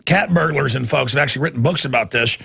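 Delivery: ordinary delivery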